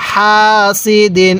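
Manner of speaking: হা held long and steady, then সি দিন; elongation where marked. Shown as a demonstration. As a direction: stretch the vowel of হা before সি দিন. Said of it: "যেখানে টান দিতেছি সেখানেই টান". (হা টেনে লম্বা করে, তারপর সি দিন)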